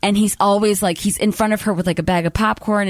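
ordinary delivery

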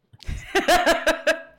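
high, loud cackle of amusement; real laugh. Real, not acted. Storyteller's dilemma over telling a star who she is, loud laugh. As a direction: burst out laughing.

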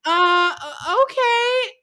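very high, shaky stammer; nervous squeak. Retold or imitated. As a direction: stammer it, totally overwhelmed. Louisa overwhelmed by fey prince.

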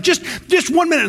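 high-pitched plea to the bouncers; pleading fan. Retold or imitated. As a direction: beg for just a minute, voice jumping up. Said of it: "And you're like".

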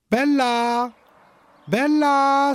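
loud high calls for the dog; calling out. Acted. Agathe calling for her lost dog, loud and high. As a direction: shout the dog's name across the farm. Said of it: "s'écrie Agathe".

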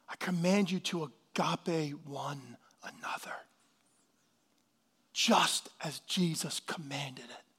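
hushed, weighty, unhurried preaching; solemn hush. Real, not acted. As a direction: deliver the command gently and reverently, almost whispered. Solemn scripture after tender grandchild story.

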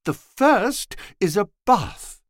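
high, slow coy lady's voice. A narrator's reading. Woman's quoted voice in story.

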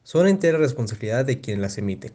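deep announcer read; formal disclaimer voice. Acted.

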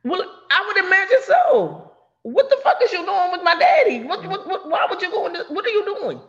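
high, loud indignant outburst; outraged confrontation. Acted. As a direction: demand answers in a high, stammering yell. Acts out daughter confronting friend.

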